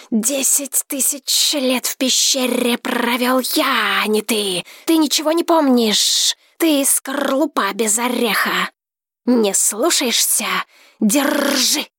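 angry dragon. A narrator's reading. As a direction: rage as an ancient beast. Dragon rages at him.

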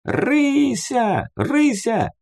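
high-pitched calling out to someone; drawn-out high call toward the house; ringing boy's call. A narrator's reading. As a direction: call her name high, like a young lad. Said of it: "звонко закричал паренёк".

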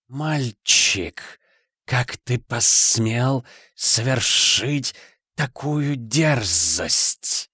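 very slow hissing serpent demon; demonic hiss. Acted. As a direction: hiss it like a many-voiced demon. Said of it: "прошипел демон сотнями голосов".

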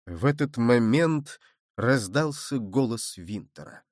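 ordinary delivery, nothing marked

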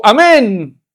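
breezy, overjoyed yes-man; very happy. Acted. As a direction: act out the man jumping at an easy deal. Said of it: "se puso muy feliz y dijo".

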